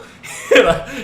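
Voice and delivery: high burst of laughter; loud laugh. Real, not acted. Laughing while telling the fight.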